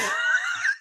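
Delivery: high shrieking cackle; shrieking laugh. Pretend. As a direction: squeal with gleeful laughter. Gloating laugh after taunt.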